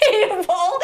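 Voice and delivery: high burst of laughter on "table"; recalling husband's kick under the table, laughing. Real, not acted. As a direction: laugh through the word. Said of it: "like I'm gonna squash it".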